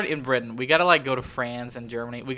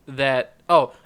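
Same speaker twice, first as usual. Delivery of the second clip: ordinary talk, nothing marked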